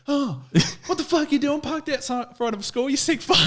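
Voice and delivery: very high squeaky woman voice, half laughing; mock shrill Karen. Mocking the woman who rattled his van door. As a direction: squeal it like an outraged busybody. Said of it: "She goes".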